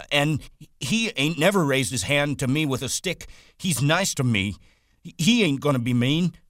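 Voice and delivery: Lenny defending George against the rabbit, belligerent and high; belligerent retort. A narrator's reading. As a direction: fight back like a hurt child. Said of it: "Lenny retorted belligerently".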